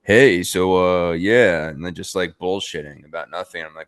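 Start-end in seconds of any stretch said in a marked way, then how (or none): 0.0-1.7 louder mock announcer on a mic; Undertaker. Retelling Undertaker on a microphone at ringside, lightly imitated. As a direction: imitate someone casually stalling on the microphone, loudly. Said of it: "got a microphone. He's like".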